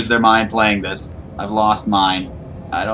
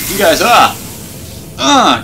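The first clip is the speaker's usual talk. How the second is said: sudden loud high cry cutting off the sentence; real jump-scare yell; startled scream; 10 semitones higher, 4 dB louder than usual. Real, not acted. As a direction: jolt of fright mid-word.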